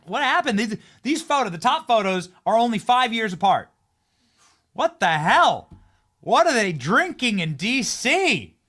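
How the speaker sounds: high, loud, stunned outburst; can't believe the photos; gasping at photos five years apart, loud and high; shocked disbelief. Real, not acted. Shocked at before-and-after photos.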